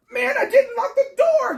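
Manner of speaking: high, loud, crying-laughing shout; shrieking realization halfway down the driveway; panicked wail. Retold or imitated. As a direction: wail it in sheer dismay. Realizes door left unlocked.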